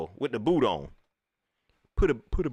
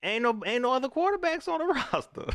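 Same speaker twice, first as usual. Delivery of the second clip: high mock exclaim; 14 semitones higher than usual. Mocking rant about roster.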